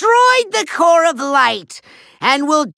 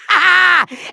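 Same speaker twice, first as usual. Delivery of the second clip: short smug villain laugh; evil chuckle; 4 semitones higher than usual. Acted.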